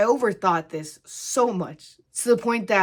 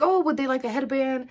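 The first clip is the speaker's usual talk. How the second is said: sweet offer; 7 semitones higher than usual. Retold or imitated. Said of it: "we'd be like".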